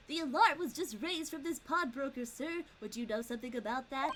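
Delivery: stock policeman character voice; generic guard voice. Acted. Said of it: "the generic guard voice that I always do".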